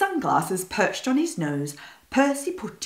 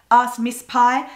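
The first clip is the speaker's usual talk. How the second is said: plain reading voice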